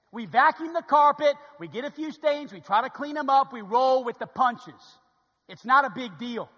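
loud, high preaching shout; fired-up preaching. Real, not acted. Preacher insisting to church a building is no big deal, loud fired-up shout. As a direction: preach it hard, slowing on the punches.

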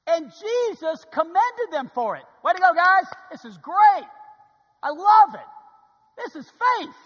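loud, high, hammed-up cheer; booming praise. Preacher voicing Jesus praising the men, loud hammed-up cheer. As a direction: cheer them on like a proud coach.

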